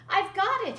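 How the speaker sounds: high, loud burst of joy; excited cry. A narrator's reading. Bird crying out as he finally dances. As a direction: shout out the triumph. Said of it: "he cried".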